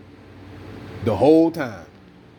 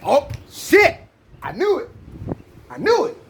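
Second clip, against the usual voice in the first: triumphant shout; 10 semitones higher than usual. Retold or imitated. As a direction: crow it, vindicated. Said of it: "I said".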